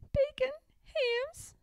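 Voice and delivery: high, slow and cheery; breezy little-pig cheer; cheerful. Acted. Pigwig tells Pigling her fate, high and cheerful. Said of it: "replied Pigwig cheerfully".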